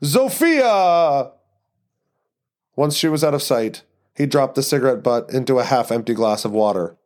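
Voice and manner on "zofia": stage actor calling out "Zofia!"; calling after her like a stage lead; theatrical call. Acted. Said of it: "like the lead in an off-Broadway production".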